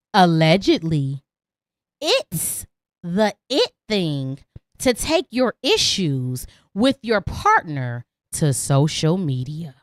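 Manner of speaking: low, slow announcer voice; deep slow podcast segment read; dramatic segment intro. Acted. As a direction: drop the voice and draw it out like a show opener.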